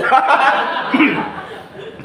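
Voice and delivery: high airy laugh with no voice; long breathy laugh. Real, not acted. Laughs at the evasive answer.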